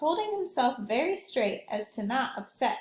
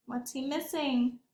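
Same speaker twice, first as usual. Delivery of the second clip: ordinary delivery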